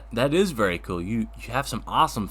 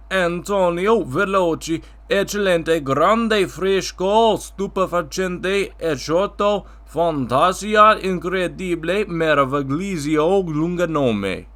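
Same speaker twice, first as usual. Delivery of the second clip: put-on Italian accent; 3 semitones higher than usual. Guest recites full Italian name.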